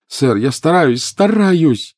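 loud, rising desperate cry. A narrator's reading. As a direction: cry out in despair. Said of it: "отчаянно вскрикнул Гарри". (отчаянный вскрик)

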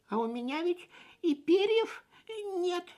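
high, startled little chick; gasping realisation. A narrator's reading. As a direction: gasp it in sudden dismay. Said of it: "ахнул Фип".

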